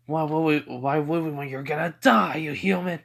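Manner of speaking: loud, high, taunting demon voice; demons' threat. Acted. Demons refusing Tanjiro's questions, loud scornful threat.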